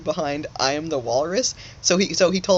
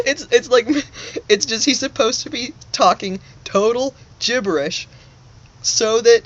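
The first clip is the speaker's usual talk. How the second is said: laughing; 8 semitones higher, 6 dB louder than usual. Real, not acted. Laughing after chant.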